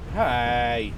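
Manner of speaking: normal talking voice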